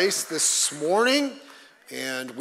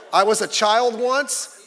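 ordinary talk

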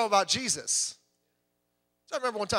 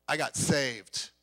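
slow, lower "I got saved"; slow confession; 6 semitones lower, much slower than usual. Retold or imitated. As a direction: let it land slowly and plainly. Confessing faith to the girl.

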